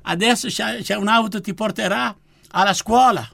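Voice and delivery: high, loud, eager announcement; excited nun. Retold or imitated. The nun tells him he starts school tomorrow, high and loud. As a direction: burst out with the good news, high and loud.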